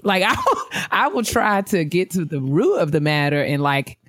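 ordinary delivery

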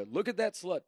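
high, loud imitation of a heckler; jeering commentator's holler, played for laughs; mock heckling shout. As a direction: call it out across a crowd. Commentator heckling crowd, retold.

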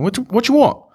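high, hurried mock impatience; rushed city counter worker. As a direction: fire it off like a fast-food worker in a rush. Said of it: "and they're fast paced".

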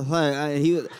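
usual speaking voice

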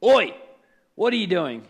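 put-on sharp loud "Oi!" then a firm question, like catching a kid in the hall; teacher calling out. Teacher catching a student in the hallway, loud call-out. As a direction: bark it down the hallway. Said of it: "a teacher says".

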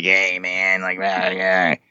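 mock electronic-sounding tracheotomy buzz. Guest imitating the arguing neighbor in the next room, tracheotomy voice. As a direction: do a crude impression of a man with a tracheotomy. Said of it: "sounded like he had a tracheotomy".

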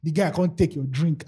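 ordinary talk

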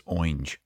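"orange" squeezed into one syllable, like "oinj"; imitating dad's "orange". Host telling what he told his wife about dad, "orange" said as "oinj". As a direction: say "orange" as "oynge". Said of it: "Kept saying orange".

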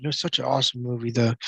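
ordinary talk

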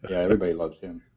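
ordinary talk, nothing marked

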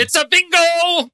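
high, slow, theatrical movie villain line; movie villain quote. Retold or imitated. Quoting the film villain to the group with slow theatrical glee. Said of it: "it's Inglourious Basterds".

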